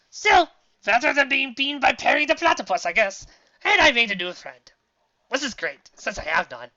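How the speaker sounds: a hint of eccentric villain; cheered-up mad scientist. A narrator's reading. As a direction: console yourself, lifting the pitch. Scientist talks to himself.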